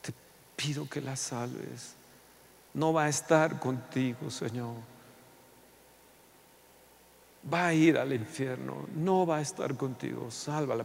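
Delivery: tearful prayer. Acted. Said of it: "llore y llore, diciéndole".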